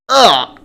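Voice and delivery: loud, high exasperated "ugh"; fed-up groan, pushed up high; annoyed groan. Real, not acted. Annoyed groan about the streamer.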